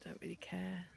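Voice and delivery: a careless little whisper; whispered shrug. Real, not acted. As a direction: breathe it out in a whisper. Shrugging off the insects.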